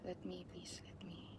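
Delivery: soft, desperate whisper begging; whispered pleading. Real, not acted. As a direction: plead under the breath. Begging while buying tickets.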